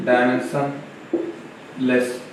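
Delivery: drawn-out term. Shown as a demonstration. Teacher spelling out a key word to students, slow and soft. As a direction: let dimensionless linger, every syllable slow and soft. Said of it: "teacher stressing new term".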